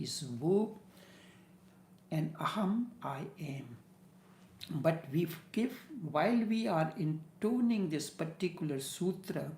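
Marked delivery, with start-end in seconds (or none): none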